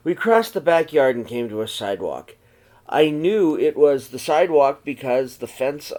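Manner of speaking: gentle read-aloud storytelling voice; story narration. A narrator's reading. Teacher reading a snowy night story to the children, in a gentle read-aloud voice. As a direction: read it to the class like a picture book. Reads picture book to class.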